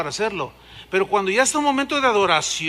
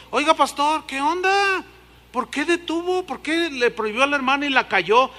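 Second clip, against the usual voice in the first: higher questioning voice of the brethren; puzzled complaint; 7 semitones higher than usual. Retold or imitated. Church members questioning the pastor, higher puzzled voice. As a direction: mimic the curious church members.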